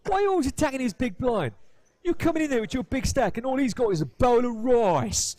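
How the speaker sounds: loud, high, indignant Cockney; Cockney accent. Retold or imitated. Imitating a railbird telling off a big-stack bully, outraged Cockney accent. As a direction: protest across the table in broad East End. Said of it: "But I said it like that".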